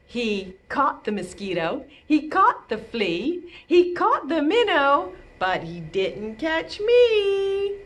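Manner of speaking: rising playful rhyme; building rhyme. Pretend. Turtle rhyme, pitch climbing each line.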